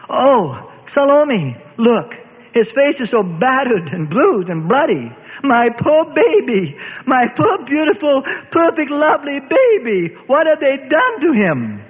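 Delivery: mother weeping over her battered son; rising distraught wail. A narrator's reading. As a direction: cry out in grief for her son. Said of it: "Her voice was now rising in volume and pitch".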